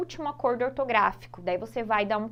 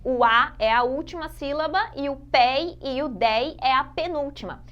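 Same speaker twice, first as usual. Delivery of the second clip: ordinary delivery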